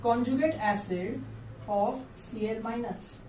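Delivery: conjugate acid of CL minus, every word slowed; teacher modelling the reading, very slow; slow reading aloud. Shown as a demonstration. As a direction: dictate the name slowly. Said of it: "HCL को कैसे पढ़ते हैं".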